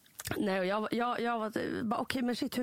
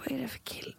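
unvoiced whisper, a bit softer; whispered shock; 3 semitones higher, 4 dB quieter than usual. Real, not acted. Host whispers back her disbelief at the guy.

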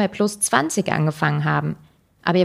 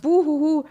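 mocking fake boo-hoo, high; a taunting fake boo-hoo in a high voice; mock sobbing; 9 semitones higher than usual. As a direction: whine a sarcastic fake cry. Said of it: "als das arme Opfer stilisieren".

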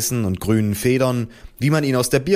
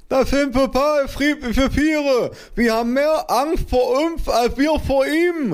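slow, very high muffled lisp; mother calling out; slower than usual. A narrator's reading. Mother defending the hornets, high muffled cry. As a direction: plead high through a badly swollen mouth. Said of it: "rief meine Mutter".

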